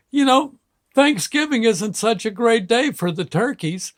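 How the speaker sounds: put-on high-pitched, upset little-boy voice; small child sadly making his point; imitating tearful child. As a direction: lightly mimic a kid holding back tears. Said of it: "slurping up the tears".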